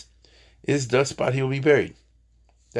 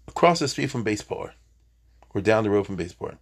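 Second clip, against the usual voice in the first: ordinary talk, nothing marked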